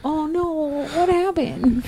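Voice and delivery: high, soft mock dismay. Retelling seeing fat Thor on screen, soft mock dismay. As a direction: gasp it like a letdown. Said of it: "I was like".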